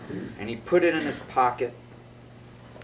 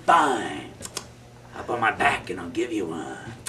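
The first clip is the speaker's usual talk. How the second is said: lower, softer rat voice; gruff rat; 10 semitones lower, 6 dB quieter than usual. Acted. Giant rat offering Willie a ride, low and soft. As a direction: grumble the offer.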